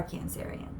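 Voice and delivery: whispered name, barely voiced. Real, not acted. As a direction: breathe the sign's name as a secret. Addressing the sign softly.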